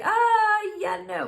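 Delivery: high, loud yelp then a hesitant no; nervous refusal. Retold or imitated. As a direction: squeak out a scared refusal. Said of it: "And I was like".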